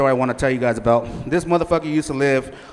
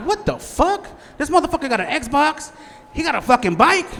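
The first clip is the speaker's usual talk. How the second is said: much higher, squeaky incredulous rant; stunned disbelief; 12 semitones higher than usual. Retold or imitated. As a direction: sound like your brain just broke. Retold shocked reaction.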